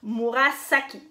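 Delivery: Murasaki with a long, hissed S in "saki"; S non voisé. Shown as a demonstration. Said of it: "vous prononcez S". (Murasaki avec un « s » sifflant, bien appuyé)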